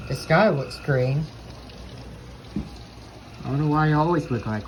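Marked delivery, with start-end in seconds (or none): none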